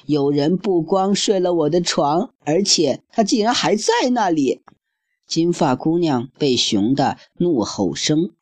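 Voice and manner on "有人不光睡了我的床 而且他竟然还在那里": higher childlike mock-sobbing voice; sobbing baby bear. Baby bear finding the girl in bed, mock sobbing. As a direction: whimper like a tiny bear. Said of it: "抽泣着说道".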